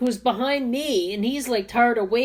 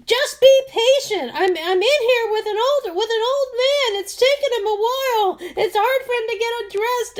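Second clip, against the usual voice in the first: put-on high old woman voice; 10 semitones higher, 4 dB louder than usual. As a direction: imitate the old woman in the booth. Older woman answers from dressing room.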